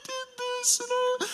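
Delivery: put-on high-pitched tearful child's wail; mock crying. Son tearfully confessing trouble to dad, mock crying. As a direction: imitate a sobbing child, squeaky and high. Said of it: "he's all crying".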